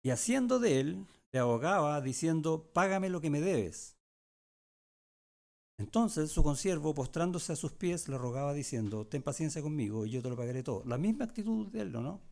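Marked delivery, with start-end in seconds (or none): 0.0-10.8 scripture reading. Acted. Pastor reads Matthew's parable to the congregation, a raised, deliberate reading. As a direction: read the verses out to the flock.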